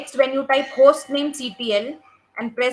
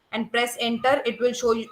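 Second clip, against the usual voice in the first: ordinary delivery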